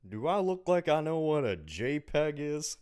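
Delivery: deadpan Texas dad complaint; Hank Hill voice. Retold or imitated. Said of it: "that was so good".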